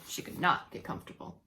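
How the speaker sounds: ordinary delivery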